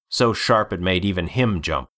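plain reading voice

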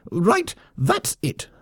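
high, slow furious squeak; enraged lemming outburst. Acted. Lemming colonel snaps and orders the attack, high furious. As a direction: lose your temper completely. Vok explodes.

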